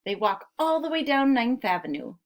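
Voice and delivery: ordinary talk, nothing marked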